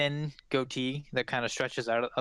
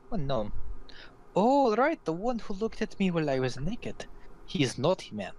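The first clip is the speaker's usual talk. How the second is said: put-on accented, jumping high on the sudden recognition; playfully indignant foreign woman; arch Vistani woman; 5 semitones higher than usual. Vistani woman recalls the peeping gnome, arch and accented.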